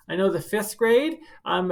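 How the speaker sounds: ordinary delivery, nothing marked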